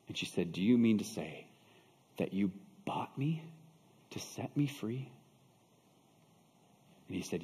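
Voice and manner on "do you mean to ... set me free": quiet wonder with long pauses; hushed disbelief. Acted. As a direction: ask it haltingly, barely daring to believe. Slave softly asks in dawning disbelief.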